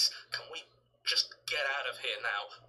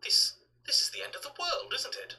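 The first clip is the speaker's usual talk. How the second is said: hushed dread at the end; breathless, high disbelief; stunned, fearful whisper. Acted. End of the world arrives.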